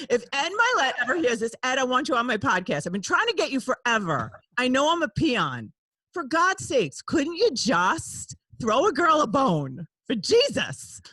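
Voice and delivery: high, loud begging with playful desperation; exasperated mock plea. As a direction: plead with a big shot who keeps ignoring you. Playful plea to absent podcast guest.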